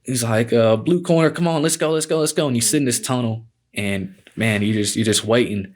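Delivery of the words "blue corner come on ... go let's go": higher, insistent retold call; urgent calling-out on one raised pitch; commissioner's summons. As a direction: imitate an official hurrying a fighter out. Voicing commissioner summoning fighter.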